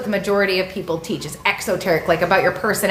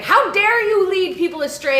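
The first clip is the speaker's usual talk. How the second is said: indignant outburst; 10 semitones higher than usual. Retold or imitated. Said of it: "I'm like".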